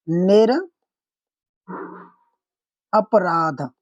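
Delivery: निर, a pause, then अपराध, said slowly and apart; split into parts. Shown as a demonstration. Teacher to students splitting the word, slow separated parts. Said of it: "ये होता है निरपराध".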